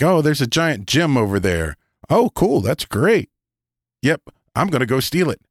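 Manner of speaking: high, eager, chirpy player; excited player. Retold or imitated. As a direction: gush like a greedy player. Player's greedy reaction retold.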